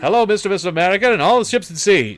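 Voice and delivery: high, loud, fast Mid-Atlantic announcer voice; hammed-up Mid-Atlantic radio accent, booming it out. Old radio announcer greets America in a hammed-up Mid-Atlantic accent. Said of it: "a delivery that was a pseudo-English".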